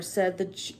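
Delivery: ordinary delivery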